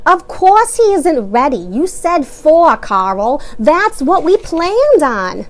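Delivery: loud, slow, annoyed mother. A narrator's reading. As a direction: press each word, fed up with Carl. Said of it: "Missus Pompaday sounded annoyed".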